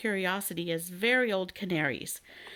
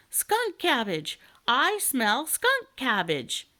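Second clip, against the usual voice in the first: slow, higher, eager cry of the dragon; excited exclaim; 5 semitones higher, 4 dB louder, slower than usual. A narrator's reading. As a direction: sniff out the treat and call it loudly. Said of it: "interrupted the dragon".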